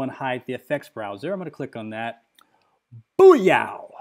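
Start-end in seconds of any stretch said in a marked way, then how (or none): 3.2-3.9 loud, higher mock-triumphant burst; sudden loud "gotcha" exclamation. Real, not acted. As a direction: blurt it out loud as the crash hits. Demonstrates the software crashing on click.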